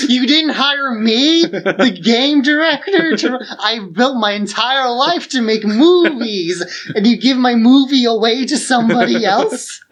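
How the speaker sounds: high, loud, slow outraged rant with a sob; mock Kojima tantrum. Host imitating an outraged Kojima, high and tearful. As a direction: ham up a wounded auteur's meltdown. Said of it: "be like".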